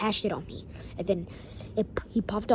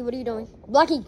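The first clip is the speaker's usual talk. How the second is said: ordinary delivery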